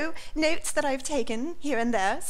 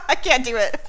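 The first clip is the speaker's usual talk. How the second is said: laughing; 3 semitones higher, 5 dB louder than usual. Real, not acted. Breaks up laughing mid-update.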